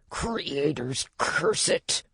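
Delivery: hushed snarl, mostly breath; quiet, rasped oath in character; aggrieved snarl. A narrator's reading. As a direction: curse under the breath, aggrieved. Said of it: "Dewdrop snarled, sounding aggrieved".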